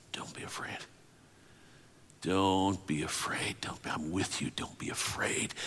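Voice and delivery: soft unvoiced whisper. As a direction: whisper reassurance like God speaking. God's whispered word.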